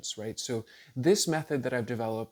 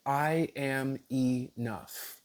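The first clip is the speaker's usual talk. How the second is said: "I am enough" very slowly, each word drawn out; deliberate affirmation; much slower than usual. Shown as a demonstration. Teacher repeating a sample intention for viewers, slow deliberate affirmation.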